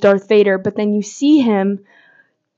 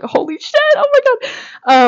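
stunned gasp. Retold or imitated. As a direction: let the awe climb up and stretch out. Recalling her own stunned reaction.

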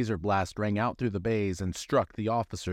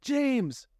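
yelled name. Acted. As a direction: shout his name. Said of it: "Amanda yelled".

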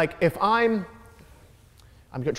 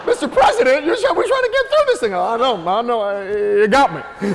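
high, loud exasperated plea; retold mock plea; 7 semitones higher, 5 dB louder than usual. Comedian begging the president to get through it, mock plea. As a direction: cry out as if begging through laughter.

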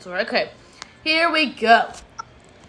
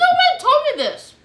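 surprised; 7 dB louder than usual. Real, not acted. Protesting news she must review.

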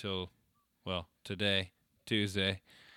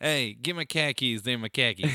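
put-on louder Boston accent, dropping the r in khakis; mock Boston accent for khakis; 5 dB louder than usual. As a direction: bark it like a Boston guy. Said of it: "very much Boston".

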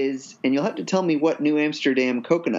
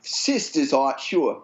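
put-on Boston accent; slower than usual. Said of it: "our best Boston accented".

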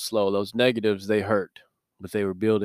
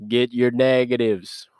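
slow, louder Southern drawl of a gym coach; Southern coach accent; 4 dB louder, slower than usual. Retold or imitated. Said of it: "get all in your face".

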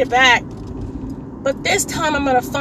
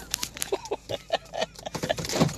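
airy giggle, pitched way up; high breathy laugh; 12 dB quieter than usual. Real, not acted. Podcaster pulling up to the liquor store, high airy giggle. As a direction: let out a high, unvoiced giggle.

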